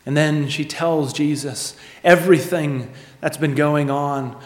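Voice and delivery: ordinary delivery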